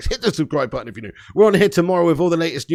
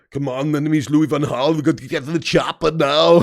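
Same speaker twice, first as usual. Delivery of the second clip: put-on lightly done action-hero accent, louder; a hint of Arnold ordering a getaway; Schwarzenegger voice; 4 dB louder than usual. Joking action-film order to the chopper, mock Arnold. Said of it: "Schwarzenegger-like van Gaal voice".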